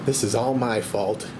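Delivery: normal talking voice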